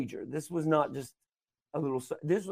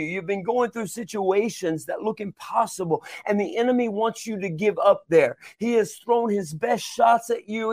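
higher preaching voice with punched phrases; impassioned preaching; 5 semitones higher than usual. Acted. Preacher urging listeners not to give up, impassioned.

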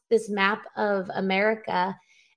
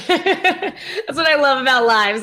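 slow, louder words through real giggles; laughing; 4 semitones higher, 5 dB louder than usual. Real, not acted. Mother amused by her son's interruption on a live, laughing. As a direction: laugh it off, pitched high. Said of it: "I'm laughing".

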